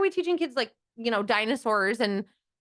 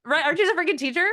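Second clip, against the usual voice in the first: louder, higher mock outrage at the teacher; mock indignant; 9 semitones higher, 6 dB louder, faster than usual. Child self scolding a teacher, mock indignant and loud. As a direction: play the offended kid demanding answers.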